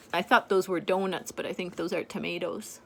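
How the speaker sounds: usual speaking voice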